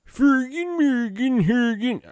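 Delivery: very high sing-song mock-Swedish gibberish; Swedish Chef. Voiceover guy dodging the client's order, high mock-Swedish babble. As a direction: babble in a squeaky fake Swedish accent. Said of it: "Are you Swedish chefing on me?".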